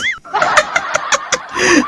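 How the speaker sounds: high, soft laugh; high giggling laugh; amused laugh. Real, not acted. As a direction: chuckle at the absurdity. Laughing at the list of names.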